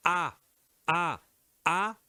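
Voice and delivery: "A" repeated as a clear open oral vowel; demonstrated A sound. Shown as a demonstration. Teacher modelling the first column, "A" as an oral vowel. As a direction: say each "A" separately, without nasality. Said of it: "première colonne".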